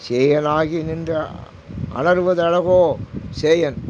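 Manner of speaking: drawn-out devotional intoning; slow verse recitation. Acted. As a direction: stretch each word of the hymn slowly. (மெதுவாக பாடல் ஓதுதல்)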